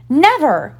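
high, sudden outburst; one sharp high exclamation; defiant cry. A narrator's reading. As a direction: cry out forgetting yourself. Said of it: "she cried".

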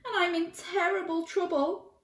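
put-on high-pitched woeful child; sniffly little boy. A narrator's reading. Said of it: "sniffed the boy".

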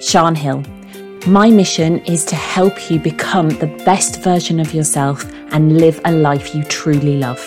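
ordinary talk, nothing marked

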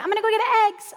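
high, sing-out imitation of a daughter; lightly voiced chirpy daughter. Quotes her daughter cheerfully announcing errand.

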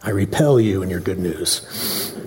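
mock grumpy listener. Pretending to be a listener who hates good news, dry grumble. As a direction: sulk at the good news, tongue in cheek. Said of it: "Hate it".

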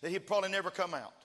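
loud, high-pitched revival preaching building to sustained shouts; full-force preaching. Real, not acted. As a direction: preach it at the top of your voice, building to a hallelujah cry.

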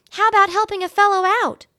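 very high little mouse voice; small mouse squeak, eager; squeaky mouse. A narrator's reading. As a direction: pipe up like a tiny mouse. Ralph the mouse speaks.

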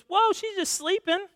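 high, drawn-out whoa; surprised objection. Acted. Jesus to mourners at the funeral, surprised objection. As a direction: halt everyone with a slow, high-pitched protest.

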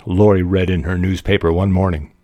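story narration. Acted. Reading a student's romantic story to listeners, animated narration. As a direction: read the story out with lifted pitch and volume.

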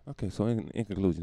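ordinary talk

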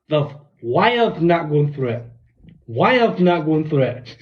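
slow, drawn-out earnest worry; slow mock Davey. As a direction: spell out the wires like a clueless caller.

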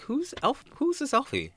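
high, loud, indignant questioning; mock suspicion. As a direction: demand to know who the newcomer is. Acting out suspicion of new co-star.

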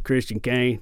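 lightly imitated stuntman's accent; a hint of the cowboy stuntman's accent; Ramon's accent. Actor imitating the stuntman calling him by name, light accent. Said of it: "he's got a really great accent".

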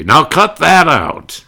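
high, loud, slow frustrated outburst; Jack Benny impression. Frustrated comedian snapping at the cast. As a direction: shout it like exasperated Jack Benny. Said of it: "when he was frustrated, he'd say".

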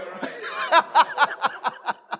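airy high laugh; breathy laugh. Real, not acted. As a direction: laugh it out, unvoiced. Laughs at own joke.

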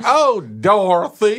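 high, drawn-out Southern belle; Blanche Devereaux. Retold or imitated. Teasing the cohost's impression, high Southern belle drawl. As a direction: swoon it in a laughing Southern drawl. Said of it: "your impression of Blanche Devereaux".

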